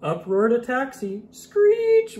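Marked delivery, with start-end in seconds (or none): 1.3-2.2 screeching brakes. Acted.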